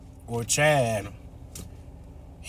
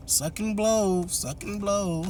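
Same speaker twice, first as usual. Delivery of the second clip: higher, slow sing-song taunt of "Suck and blow"; mock taunt; 8 semitones higher, slower than usual. Imagined mom mocks Mercedes, sing-song and slow. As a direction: drag out "blow" teasingly. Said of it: "she didn't say all that".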